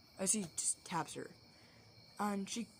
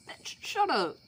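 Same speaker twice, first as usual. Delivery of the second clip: loud, high-pitched mock scolding; exaggerated exasperated outburst; mock telling-off; 8 semitones higher, 9 dB louder, slower than usual. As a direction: shut someone down loudly. Acted telling-off of Kaneki.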